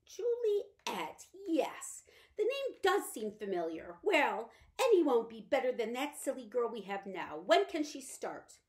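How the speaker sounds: drifting, absent-minded lady; vague invalid mother. Acted. Mother vaguely recalls the name.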